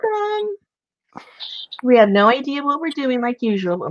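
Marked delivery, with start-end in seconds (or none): 0.0-0.5 excited welcome. Real, not acted. Greeting Gina arriving in chat.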